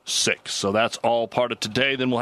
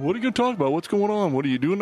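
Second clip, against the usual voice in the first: high mimicking voice; 8 semitones higher, much faster than usual. Mimicking people asking him.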